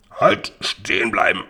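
rough robber barking an order to halt; Räuber beim Überfall. A narrator's reading. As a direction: stop them dead like a highwayman. Räuber hält die Buben an. (barscher Räuberbefehl)

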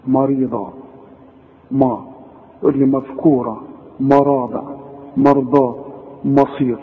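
"مريضة" with a thick, dark MA; wrong heavy Mim. Shown as a demonstration. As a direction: weigh down the M syllable of "مصير". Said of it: "ما انتش عارف تقول الميم". ("مريضة" بميم مفخمة غلط)

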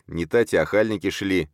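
ordinary delivery

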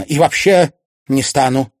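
loud, high flat-out refusal; curt refusal. A narrator's reading. Mother refusing her son any discussion, curt and loud. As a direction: shut it down firmly.

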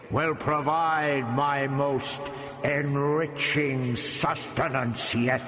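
slow, echoing, hollow command; commanding alien voice. A narrator's reading. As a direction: intone it slowly as a monstrous ruler. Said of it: "the voice ordered".

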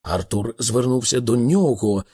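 ordinary delivery

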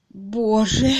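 choked little cry of shock; stifled cry. A narrator's reading. Miss Twitterton overhearing the lovers, stifled cry. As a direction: cry out with the voice held back. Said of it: "сдавленно крикнула мисс Твиттертон".

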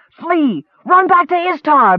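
high, ringing shout to drive them off; bellowed. A narrator's reading. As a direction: chase the horses away with a yell. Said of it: "bellowed Horgan".

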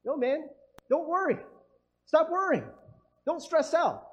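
high, pushy soothing; mock reassurance. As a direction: imitate a friend brushing off your worry. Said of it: "and they're like".

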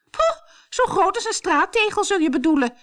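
high, loud scornful jeer; shouting. A narrator's reading. Truida jeering at her sister's feet, shouting. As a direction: shout a mocking put-down. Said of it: "schreeuwde Truida".

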